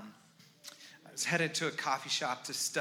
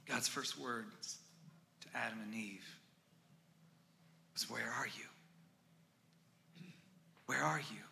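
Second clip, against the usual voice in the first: tender preaching; 2 semitones lower than usual. Acted. Soft slow tender preaching.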